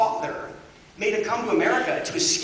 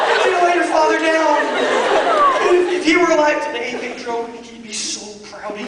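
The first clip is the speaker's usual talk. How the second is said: pep talk; 6 semitones higher, 7 dB louder than usual. Retold or imitated. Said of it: "giving him this pep talk".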